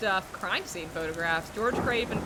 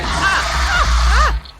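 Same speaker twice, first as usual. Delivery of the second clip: shrill panicked screaming; terrified screams; 13 semitones higher, 14 dB louder, much slower than usual. Real, not acted. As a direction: scream in real terror. Screaming as monster attacks.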